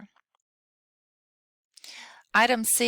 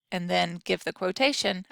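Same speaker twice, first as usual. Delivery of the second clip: usual speaking voice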